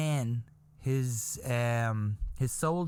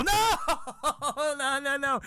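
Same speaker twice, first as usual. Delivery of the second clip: horrified disbelief; 12 semitones higher than usual. Retold or imitated. Townsperson hears the gossip, hammed-up horror. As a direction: wail the no, then patter it.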